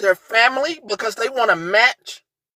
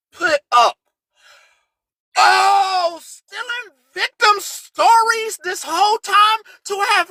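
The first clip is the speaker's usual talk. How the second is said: louder, higher angry shouting; furious rant; 8 semitones higher, 4 dB louder than usual. Real, not acted. Streamer to the woman who faked her story, furious shouting. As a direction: let the rage out at her.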